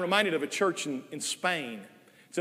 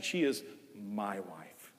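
slow, much softer and lower She is my wife; tender; 8 semitones lower, 8 dB quieter, much slower than usual. Shown as a demonstration. Husband speaking fondly of wife Nell, slow and soft. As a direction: breathe it out fondly, stress on she. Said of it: "I say it with affection".

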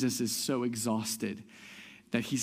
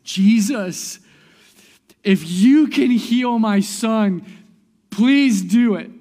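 loud, high, slow plea as a desperate father; crying out; 11 semitones higher, 15 dB louder, slower than usual. Retold or imitated. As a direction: call out over a crowd, begging. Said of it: "and he cries out in the midst of".

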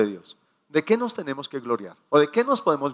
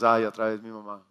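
embarrassed sigh; 6 semitones lower, 4 dB quieter than usual. Retold or imitated. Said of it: "y nosotros".

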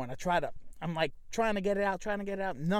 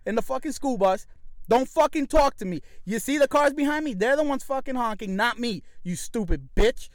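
retold furious tirade, higher and louder; pissed off; 5 semitones higher, 4 dB louder than usual. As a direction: tear into the driver, re-enacting the rage. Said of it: "this bitch got me pissed".

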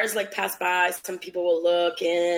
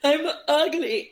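mock crying; 9 semitones higher, much slower than usual. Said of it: "I used to cry".

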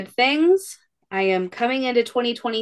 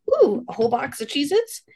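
mock greedy delight; 8 semitones higher than usual. Mom caving to snack temptation.

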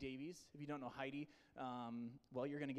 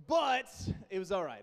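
loud grudging verdict; 4 semitones higher, 9 dB louder than usual. Real, not acted. Grudging praise after a weak yell.

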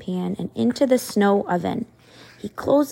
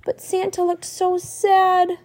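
sniffling; 8 semitones higher, 3 dB louder, slower than usual. A narrator's reading. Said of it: "William sniffles".

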